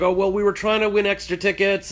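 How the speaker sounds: high, bright explaining tone; imitating Carolyn explaining; friend's excuse. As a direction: pitch it up like a cheery excuse. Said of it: "Carolyn's like".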